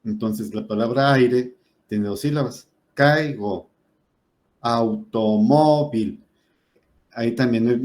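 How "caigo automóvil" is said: "Automóvil" said very slowly, syllable by syllable; slow teacher demo. Shown as a demonstration. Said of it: "dividing words into syllables".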